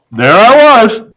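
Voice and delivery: ordinary delivery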